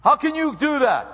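high, loud mock scolding; peeved parent. Parent peeved at the kids, exasperated mock scolding. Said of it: "so peeved at our kids".

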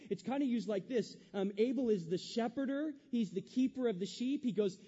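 loud, rising preacher's voice; booming sermon delivery; full-force preaching. Acted. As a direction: preach it out to the congregation.

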